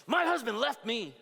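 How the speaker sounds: high, loud, upset woman's cry; mad lady. Retold or imitated. Angry wife at the book table tells the pastor, high and loud. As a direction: wail it like an angry abandoned wife. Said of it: "She was so mad".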